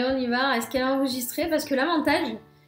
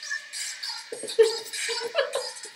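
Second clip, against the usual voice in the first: stifled giggles; 9 semitones higher, 8 dB quieter than usual. Real, not acted. Laughing at silly video.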